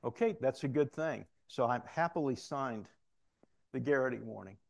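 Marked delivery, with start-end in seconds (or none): none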